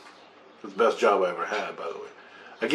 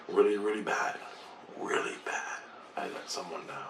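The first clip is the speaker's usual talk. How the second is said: hushed, sinking almost to a whisper; guilty confession; 2 semitones lower, 10 dB quieter than usual. Retold or imitated. Telling his buddy what the guilt felt like, hushed confession.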